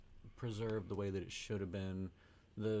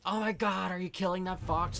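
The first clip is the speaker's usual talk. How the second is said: high, louder mock horror; mock horrified girlfriend; shocked girl; 10 semitones higher, 8 dB louder than usual. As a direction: imitate an appalled young woman. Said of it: "This girl's like".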